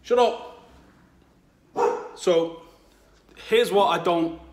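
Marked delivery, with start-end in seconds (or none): 0.0-0.7 much higher, louder sharp shout; real yell at dog. Real, not acted. Fed up with the barking dog, yells at it. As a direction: bark the order at the barking dog.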